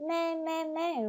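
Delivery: imitating a goat bleat. Shown as a demonstration. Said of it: "this is its sound".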